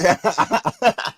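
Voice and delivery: bursting high laugh; high loud laugh. Real, not acted. Laughing at own story.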